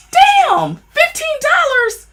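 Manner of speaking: high, slow, drawn-out disbelief; shocked at the price. Real, not acted. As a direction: squeal the price in outrage.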